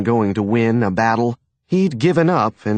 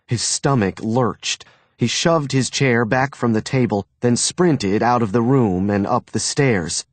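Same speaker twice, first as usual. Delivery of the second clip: usual speaking voice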